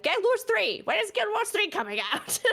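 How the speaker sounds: high nagging mock fan; whiny fan voice. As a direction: squeak it like an impatient fan. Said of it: "anybody that's like".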